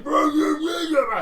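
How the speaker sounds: high, loud nonsense arguing; loud mock bickering. The ogres back to arguing, high and loud. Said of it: "they're just like".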